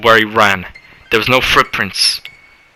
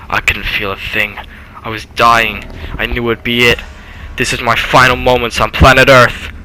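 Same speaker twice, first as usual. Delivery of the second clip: ordinary delivery, nothing marked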